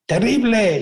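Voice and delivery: put-on a boy testing the echo with a shout; child shouting into a cave. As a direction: yell it out to hear the echo.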